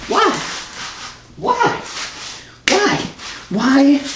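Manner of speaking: very high, pleading wail; mock-anguished whys. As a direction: play up the heartbreak in a high voice. Mock lament over failed accent prank.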